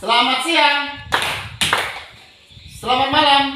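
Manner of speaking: high, loud classroom cues; called greetings. Shown as a demonstration. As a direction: call each greeting out to the class. (salam diserukan keras kepada kelas)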